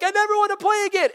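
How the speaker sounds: put-on high, pouting child's voice; whiny kid. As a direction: whimper it like a defeated little player. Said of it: "You're like".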